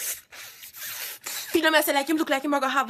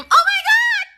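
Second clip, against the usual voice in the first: high, loud shriek of delight; boy shrieking over her hair; excited scream; 8 dB louder than usual. Retold or imitated. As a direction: squeal it at the top of your voice. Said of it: "my hear this boy start scream".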